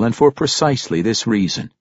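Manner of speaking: usual speaking voice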